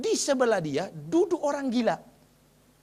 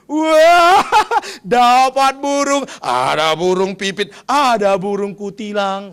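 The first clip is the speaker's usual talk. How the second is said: loud laughing cry, stretched slowly; louder, laughing madman; 11 dB louder, much slower than usual. Acted. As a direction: giggle it out like a delighted madman. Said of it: "dia bilang gini".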